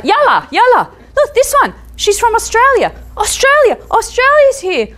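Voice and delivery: high, loud excited announcing; excited calling. Retold or imitated. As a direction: call friends over, thrilled. Excited Iraqi man calling friends.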